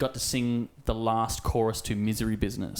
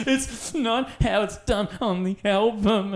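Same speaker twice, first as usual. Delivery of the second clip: put-on high, slow, whiny child complaining; whiny kid; 11 semitones higher, 5 dB louder, slower than usual. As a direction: whine like a disappointed little kid. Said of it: "I was like".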